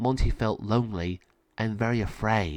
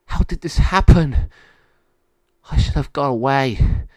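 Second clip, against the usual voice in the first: despairing; 3 semitones higher, 8 dB louder than usual. A narrator's reading. Monty despairs in cage.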